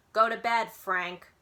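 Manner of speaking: lightly voiced grandma telling grandpa off; a hint of a bossy grandma; grandma scolding. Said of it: "and Grandma Miller says".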